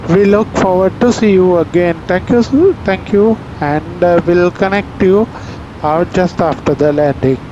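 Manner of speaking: calm airline PA voice; formal in-flight announcement; captain announcement. Real, not acted. Pilot's cabin PA announcement.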